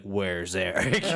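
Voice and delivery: low, slow deadpan demand; put-on low voice, stretched out; mock intimidator. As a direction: draw it out like a menacing heavy. Demonstrating the intimidating repeated question.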